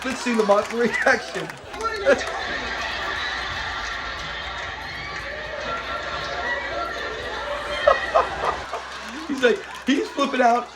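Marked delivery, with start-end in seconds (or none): 1.5-9.2 long, very high helpless laughter; real laugh. Real, not acted. Vlogger losing it at Lamont's reaction, long real laugh. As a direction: laugh until it squeaks.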